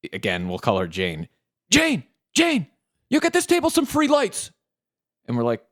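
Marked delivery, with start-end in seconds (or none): 1.7-4.5 calling out. Retold or imitated. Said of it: "And he's like".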